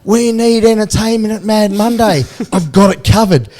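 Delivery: loud, high, drawn-out holler; going mad. Retold or imitated. Teammate going mad in the background about Mad Monday, hollering. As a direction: holler it slowly like a man possessed. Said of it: "A J just going mad".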